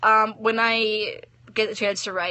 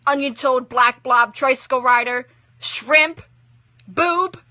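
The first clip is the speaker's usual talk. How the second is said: put-on childish insults; 4 semitones higher, 6 dB louder, slower than usual. A narrator's reading. Girl hurling childish insults.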